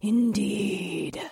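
soft, croaky hag reply; croaking. A narrator's reading. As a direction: croak it quietly.

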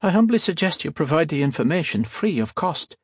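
high, lilting Irish voice; a light Irish lilt, smooth and polite. A narrator's reading. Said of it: "had an Irish lilt".

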